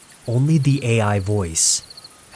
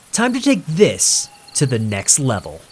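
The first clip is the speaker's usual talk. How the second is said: hyped streamer; 4 semitones higher than usual. Acted. Nathan teases stream viewers.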